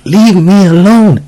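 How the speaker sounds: put-on high, drawn-out child's protest; slow, high little-kid voice; imitated young daughter. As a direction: imitate a small child telling someone off, slowly. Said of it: "she's staring at the same corner".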